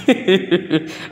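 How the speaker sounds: high real giggle; amused laugh. Real, not acted. Coach amused by the child's protest, laughing. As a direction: burst into giggles at the complaint.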